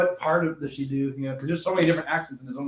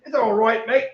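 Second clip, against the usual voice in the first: a quick lightly put-on English accent, pitched up; mock British accent; 8 semitones higher, 6 dB louder, slower than usual. Friend teasing in a British accent, playful mock English. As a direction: toss it off like a cheeky Brit. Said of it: "they do American accents the same way we do that".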